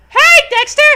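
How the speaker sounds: high, loud, grating little-sister call; bratty high-pitched shout of his name; annoying Dee Dee voice. Acted. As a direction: squeal it like a pesky sister. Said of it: "in her annoying voice".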